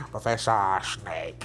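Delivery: very low, slow, hushed menace; hammed-up brooding British wizard teacher; Professor Snape. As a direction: intone it deep and drawn out. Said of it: "he was fucking Professor Snape".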